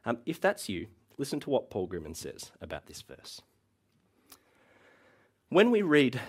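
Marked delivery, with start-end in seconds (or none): none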